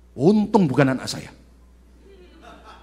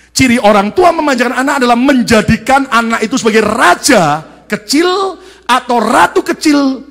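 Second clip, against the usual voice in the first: higher, louder forceful sermon; full preaching; 9 semitones higher, 12 dB louder than usual. Acted. Preacher hammering on parents who defend children blindly, loud. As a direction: drive the point home to the congregation.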